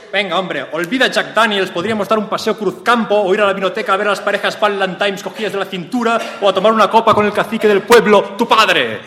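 high and loud, pushing hard; loud hammed-up coaxing. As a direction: overact the persuading suitor. Acted coaxing quote.

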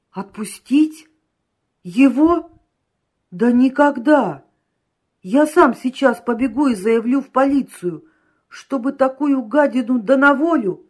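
loud, high indignant refusal; outraged. A narrator's reading. Client refusing to free the scoundrel, outraged.

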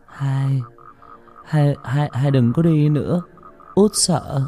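high, faltering plea; frightened stammer. A narrator's reading. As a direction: stammer in fear, repeating the first word. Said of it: "Tôi lắp bắp trả lời". (lắp bắp sợ hãi)

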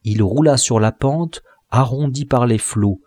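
plain reading voice

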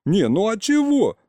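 high-pitched put-out protest. A narrator's reading. Boy hushed by the grown-ups, protesting. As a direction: push back, voice rising high.

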